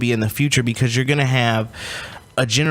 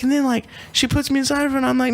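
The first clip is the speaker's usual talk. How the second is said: usual speaking voice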